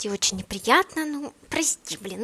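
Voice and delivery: ordinary delivery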